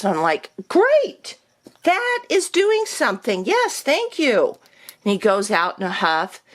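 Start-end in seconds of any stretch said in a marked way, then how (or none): 0.7-4.6 much higher, mock-cheerful gratitude; sarcastic thanks. Mocking the doctor's grudging offer with fake gratitude, sarcastic. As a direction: overdo the thanks so it stings.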